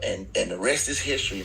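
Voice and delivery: normal talking voice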